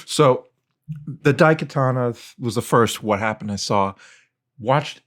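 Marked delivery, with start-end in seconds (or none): none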